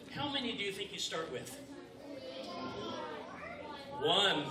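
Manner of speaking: ordinary delivery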